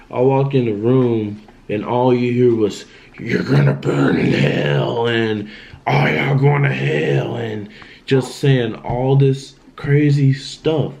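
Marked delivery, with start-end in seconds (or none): 3.2-7.4 lightly voiced eerie threat, slow; a hint of a demonic voice, drawn out; creepy possessed voice. Brother in hospital bed, lightly imitated creepy slow threat. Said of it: "in the creepiest voice ever".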